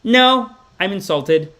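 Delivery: lightly voiced offended follower; mock offended devotee. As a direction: ham up the offended believer. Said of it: "It's like".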